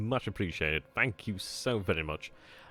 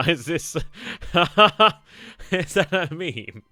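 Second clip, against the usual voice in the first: high-pitched laughter breaking through the words; laughing hard; 10 semitones higher, 9 dB louder, slower than usual. Real, not acted. Streamer laughing at a viewer's meme. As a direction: crack up while asking.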